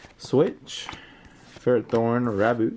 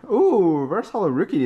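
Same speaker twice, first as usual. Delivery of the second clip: ordinary talk, nothing marked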